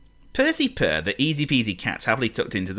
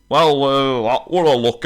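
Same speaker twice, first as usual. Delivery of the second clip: mammoth voice; 5 semitones higher, 6 dB louder than usual. Acted. Mammoth voice again.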